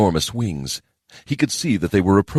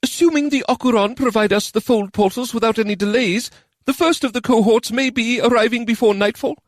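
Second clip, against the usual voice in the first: higher, uneasy report; evasive answer. A narrator's reading. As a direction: answer while looking away. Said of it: "Kisei answered as he looked away".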